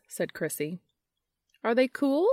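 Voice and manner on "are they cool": higher, eager hopeful question. A narrator's reading. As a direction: ask it full of hope. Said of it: "asked Charlie hopefully".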